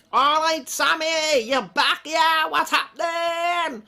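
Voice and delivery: loud, high, delighted greeting; excited greeting. Real, not acted. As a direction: hail a returning friend. Greeting a returning viewer.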